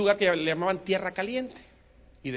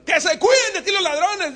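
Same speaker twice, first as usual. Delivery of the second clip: emphatic repeat; 14 semitones higher, 11 dB louder than usual. Real, not acted. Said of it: "preacher repeats the line".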